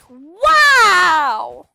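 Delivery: loud drawn-out sarcastic wow, falling away. Shown as a demonstration. Player mocking her own zombie game, sarcastic. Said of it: "Me being sarcastic".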